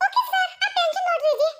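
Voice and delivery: high secretary voice, no longer crying; tears suddenly gone. Acted. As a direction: reassure the boss, instantly over the tears. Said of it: "आंखों में से आंसू ऐसे गायब".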